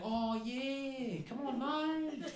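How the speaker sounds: high, drawn-out Australian coaxing; Australian calling through. Retold or imitated. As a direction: beckon them over, easygoing Australian accent. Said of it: "he just kept shouting through".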